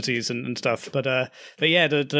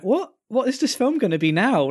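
baffled disbelief; 8 semitones higher than usual. Retold or imitated. Acting out a baffled viewer's reaction.

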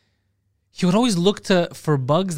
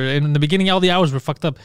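usual speaking voice

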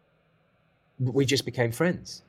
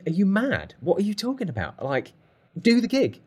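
high-pitched, exasperated mock rant; furious telling-off; 9 semitones higher, 3 dB louder than usual. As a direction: imitate a mentor incredulous with anger. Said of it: "Glenn was furious".

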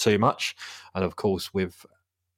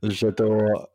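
hammed-up French accent on a single word; mock French accent. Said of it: "the French one".

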